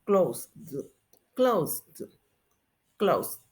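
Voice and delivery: "closed" spaced out, final consonant released separately; candidate endings demo. Shown as a demonstration. Teacher lets students compare endings, careful isolated "closed". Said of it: "cómo se pronuncia el pasado".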